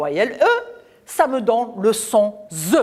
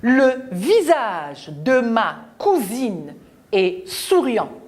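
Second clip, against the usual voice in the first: reads the sentence slowly and clearly; slow reading aloud; 2 semitones higher, slower than usual. Shown as a demonstration. Teacher reads the example sentence slowly to the children. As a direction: spell each word out for the class. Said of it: "je vais lire la phrase".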